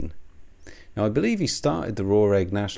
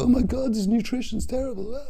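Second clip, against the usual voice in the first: very high prissy squeal; mocking whiny voice. Mocking Raw Egg Nationalist's nutrition complaints, very high. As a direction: ham up a fussy nutrition snob. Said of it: "Stuff that he would go".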